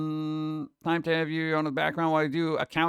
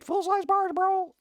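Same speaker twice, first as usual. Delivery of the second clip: much higher, squealing over candy; excited kid squeal; slower than usual. Retold or imitated. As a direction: squeak it like a thrilled trick-or-treater. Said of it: "everyone's just gonna be walking around".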